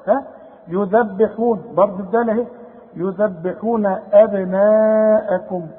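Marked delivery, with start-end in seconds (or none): 3.0-5.7 أبناءكم with the aa before the hamza held four counts; obligatory madd. Shown as a demonstration. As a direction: stretch أبناءكم slowly. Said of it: "اهو ده المد الواجب". (مد أبناءكم أربع حركات)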